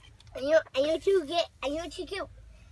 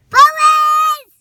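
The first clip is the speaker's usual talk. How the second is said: high, loud shouted call; yelling; 13 semitones higher, 16 dB louder than usual. Pretend. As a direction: yell it out high and sharp. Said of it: "Why are you yelling police?".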